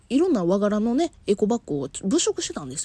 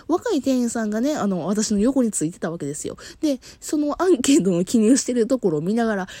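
ordinary delivery

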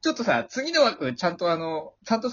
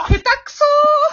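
very high, drawn-out scream; hammed-up high holler; mock heckling yell; 8 dB louder, much slower than usual. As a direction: jeer at the top of your voice, slowly. Heckling the bad shout.

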